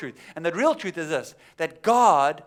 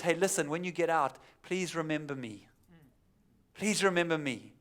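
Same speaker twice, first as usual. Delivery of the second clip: pleading softly; 2 semitones lower, 7 dB quieter than usual. Retold or imitated. Joseph pleads with the butler.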